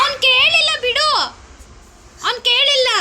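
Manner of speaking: loud, high-pitched insistence. Real, not acted.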